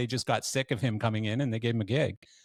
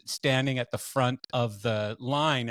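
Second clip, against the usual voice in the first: ordinary delivery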